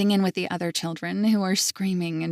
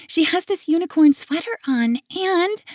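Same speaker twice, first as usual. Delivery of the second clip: put-on much higher child plea; begging little girl; 10 semitones higher, 5 dB louder than usual. Little girl begging grandpa for a toy, high. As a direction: pitch way up like a pleading child. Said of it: "she says louder".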